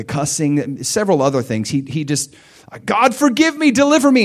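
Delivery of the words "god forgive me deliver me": high, loud cry for mercy; pleading prayer. Retold or imitated. Preacher retelling his high, loud prayer to God. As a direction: cry out for deliverance.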